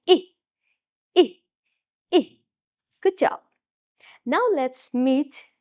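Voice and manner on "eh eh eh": Eh as short i like itchy; short I sound. Shown as a demonstration. Teacher acting out brushing off an insect, short i. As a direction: flick it off with a quick short i for Eh. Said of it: "Pretend to flick it off and say".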